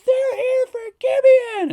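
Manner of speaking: high, loud mocking call. Manager calling out to the whole wharf, high and loud. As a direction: holler it down the docks. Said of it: "he's calling out".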